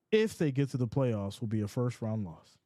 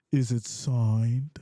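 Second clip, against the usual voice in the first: slow drawn-out question; 3 semitones lower, 4 dB louder, much slower than usual. Shown as a demonstration. Host showing viewers how to ask it, slow drawl. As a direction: stretch out Is it signed slowly and lower. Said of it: "That's how you gotta say it".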